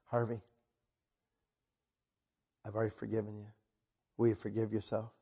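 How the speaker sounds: soft, low, tender voice; gentle forgiveness. Retold or imitated. God answers the sorry preacher, quietly forgiving. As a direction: drop right down to reassure kindly.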